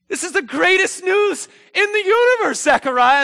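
very high, loud proclamation; overjoyed shout. Acted. Gabriel brings Zechariah the greatest news, overjoyed shout. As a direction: proclaim it ecstatically.

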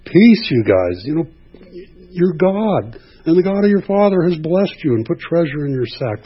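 the steward reassuring buddies; casual steward. Retold or imitated. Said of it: "Oh, you guys are here for lunch".

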